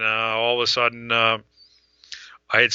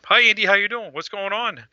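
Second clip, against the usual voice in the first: lightly imitated caller's hello, pitched up; a hint of a higher-voiced friendly caller; Peter; 7 semitones higher than usual. Peter greets Andy on the phone, lightly imitated higher voice. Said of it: "there's Peter on the phone".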